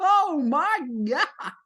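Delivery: high, slow, drawn-out gasp of disbelief; stunned oh my God. Real, not acted. Vlogger stunned the couple stayed together, high and slow. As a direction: let the shock stretch it out. Still shocked by the news.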